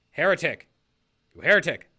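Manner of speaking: loud, stern cry of heretic; Remy impression. Mimicking Remy for the stream, a loud stern accusation. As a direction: bark it like a zealous accuser. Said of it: "There's my Remy impression".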